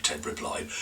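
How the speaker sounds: usual speaking voice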